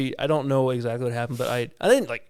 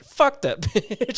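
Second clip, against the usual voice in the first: high, slow curse broken by a laugh; can't keep a straight face; 11 semitones higher, much slower than usual. Retold or imitated. Listener reacting to the story, cursing through laughter. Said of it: "I'm like".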